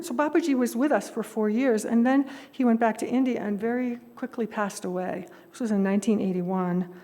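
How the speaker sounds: ordinary talk, nothing marked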